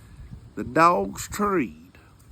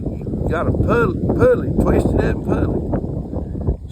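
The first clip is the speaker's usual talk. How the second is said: urgent instructions; 6 dB louder, slower than usual. Retold or imitated. Friend instructing him up the tree.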